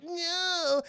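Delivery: high, drawn-out mock cry; mock wail. Imitating Anakin losing his arm.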